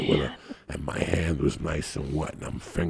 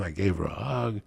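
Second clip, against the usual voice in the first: normal talking voice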